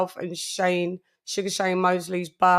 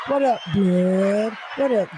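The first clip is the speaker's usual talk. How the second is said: ordinary delivery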